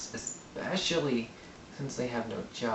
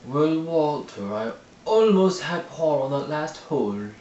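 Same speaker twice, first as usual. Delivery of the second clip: slow, high, snooty rich golfer; yuppie person voice; 5 semitones higher, 7 dB louder than usual. Retold or imitated. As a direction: put on a smug country-club yuppie. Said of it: "That's my yuppie person impression".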